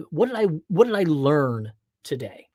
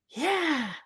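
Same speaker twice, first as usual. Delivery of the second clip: imitating son; 13 semitones higher than usual. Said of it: "he's like".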